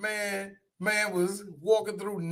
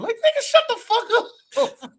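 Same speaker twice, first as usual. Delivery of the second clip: high-pitched laughing shout; laughing too hard to finish the line; laughing retort; 4 dB louder than usual. Real, not acted. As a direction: yell it while cracking up.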